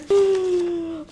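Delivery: sharp high gasp of delight. Real, not acted. Gasping as the Anne books line up together. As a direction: gasp at the matching covers.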